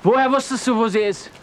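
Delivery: high, loud angry outburst; furious demand. Acted. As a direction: shout the accusation at him. Furious confrontation over her.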